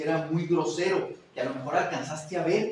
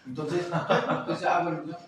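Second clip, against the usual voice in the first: laughing; 4 dB louder than usual. Real, not acted. Laughs after repeating sexo.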